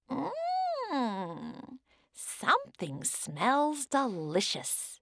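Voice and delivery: put-on high, slow old woman. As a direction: sniff the air as the old woman. Old woman voiced in story.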